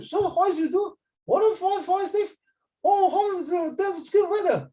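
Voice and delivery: high, hammed-up Scottish accent imitation; mock Scottish accent. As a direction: mimic a Scottish speaker with a high put-on voice, mocking. Teacher mimics Scottish people talking.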